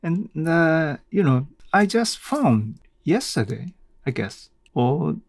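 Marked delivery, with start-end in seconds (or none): none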